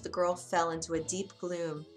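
plain reading voice

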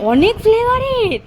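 mock surprise. Child claims many flavours.

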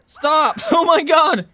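loud high yell, then a laugh; exasperated shout. Real, not acted. Controller keeps vibrating.

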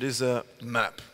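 ordinary delivery

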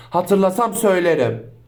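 ordinary talk

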